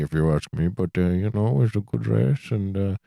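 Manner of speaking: low-pitched, bad Pakistani-sounding accent; botched Finnish impression sliding into Pakistani accent; mock Pakistani accent. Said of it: "sounds like a little bit Pakistani".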